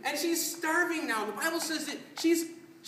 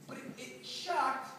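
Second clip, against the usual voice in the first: ordinary talk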